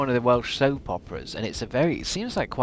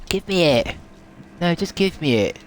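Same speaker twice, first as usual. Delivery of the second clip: higher, louder insistent demand; frustrated demand; 7 semitones higher, 4 dB louder than usual. Real, not acted. Streamer yelling at the game, frustrated demand. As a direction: demand the item, exasperated.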